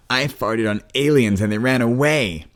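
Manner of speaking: put-on high, loud bursting kid excitement; lightly voiced eager boy; enthusiastic. A narrator's reading. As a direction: blurt it out, too excited to hold back. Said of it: "unable to hold back his enthusiasm".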